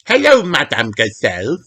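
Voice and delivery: put-on high little girl greeting; Peppa's voice. A narrator's reading. Peppa greets her teacher at the door, brightly. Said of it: "says Peppa".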